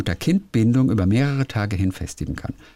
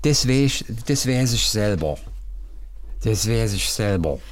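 put-on drunk Saxon accent. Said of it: "betrunkenen Sächsisch".

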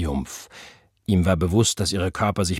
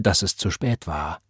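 ordinary delivery

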